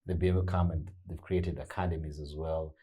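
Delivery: ordinary talk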